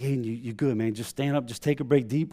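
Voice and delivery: put-on quietly steady a hurt child; soft reassurance. Dad calming his crashed son, soft reassurance. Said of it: "softer, and it was high".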